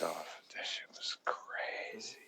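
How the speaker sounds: slow, soft awed whisper; hushed whisper. Real, not acted. Recalling the face-melting scene, slow whisper. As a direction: breathe it out in disbelief.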